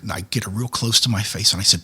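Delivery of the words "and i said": normal talking voice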